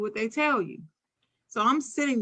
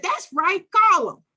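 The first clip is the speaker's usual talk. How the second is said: defiant; 9 semitones higher, 6 dB louder than usual. Retold or imitated. Defiant child daring the teacher.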